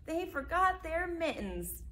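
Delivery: high, slow put-on grumble; grumbling teacher. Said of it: "mumbled and grumbled".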